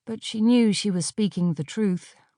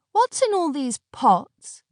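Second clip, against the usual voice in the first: demanding; 8 semitones higher than usual. A narrator's reading. Aneshka demanding.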